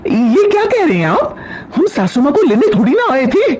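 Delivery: high-pitched, startled objection; shocked protest. Acted. Wife hears husband invite his mother home, shocked protest. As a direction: let the pitch leap in indignant disbelief. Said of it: "कीर्ति ये सुनकर चौंक जाती है".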